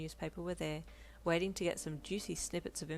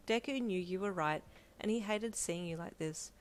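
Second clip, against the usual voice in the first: usual speaking voice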